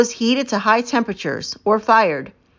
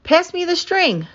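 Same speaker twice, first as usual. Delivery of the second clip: high call across the dig site, higher pitch; 10 semitones higher than usual. A narrator's reading. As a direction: call out for the string. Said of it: "calls Tara".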